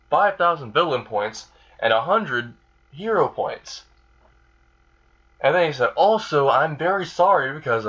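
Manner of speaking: chirpy put-on praise, pitch swooping up; Nezu. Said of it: "and Nezu said".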